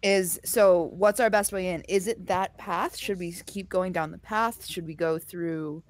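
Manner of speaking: normal talking voice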